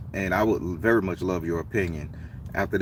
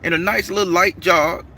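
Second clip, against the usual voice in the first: higher, mildly louder retold argument; heated argument; 10 semitones higher, 3 dB louder than usual. The Baron arguing with the stranger over the bench, heated. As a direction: argue your claim to the bench. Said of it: "I say".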